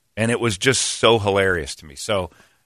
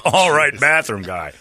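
impressed approval; 6 semitones higher than usual. Retold or imitated. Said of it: "And I'm like".

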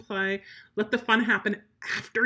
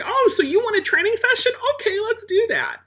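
much higher, louder, cheery "Oh, so you want a training session?"; upbeat mock invitation; 13 semitones higher, 7 dB louder than usual. Trainer talking to an obnoxious dog, high and cheery. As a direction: sweetly call the bratty dog's bluff. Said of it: "I'm like".

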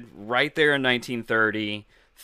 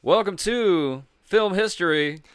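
announcer voice; 8 semitones higher, 4 dB louder than usual. Acted. Show intro take.

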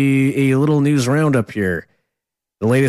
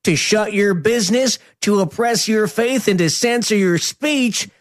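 mocking politician voice; 10 semitones higher than usual. Reading a politician's tweet mockingly.

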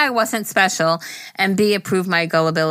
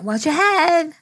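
mock warning call; 9 semitones higher, slower than usual. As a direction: sing out the warning again. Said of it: "I fucking tell everybody".

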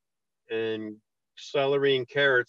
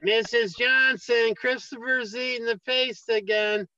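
put-on tattling kid voice; 8 semitones higher than usual.

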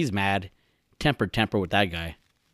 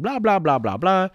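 high-pitched mock jabbering; self-mocking chatter; 9 semitones higher than usual. Podcaster mocking how he does all the talking, jabbering.

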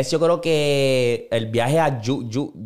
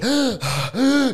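sharp high anxious gasp; 10 semitones higher than usual. Acted. Reacting to the kid's panic, a sharp high gasp. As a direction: suck in air like you're scared for him.

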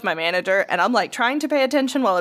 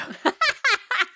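high burst of laughter; 13 semitones higher than usual. Real, not acted. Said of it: "That's fucking rude".

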